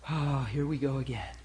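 low, soft, resigned groan; weary sigh. Acted. Preacher voicing the heart facing pain, weary sigh. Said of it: "the heart goes".

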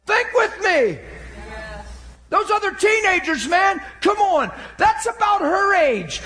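high-pitched, driving sermon delivery; full-force preacher; impassioned preaching. Real, not acted.

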